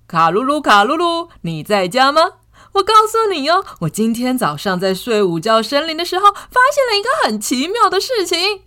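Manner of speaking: calling out. Acted. Calling at friend's door.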